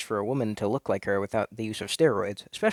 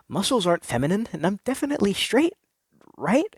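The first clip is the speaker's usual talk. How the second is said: nervous denial; 9 semitones higher, 3 dB louder than usual. Acted. Anxious self-denial.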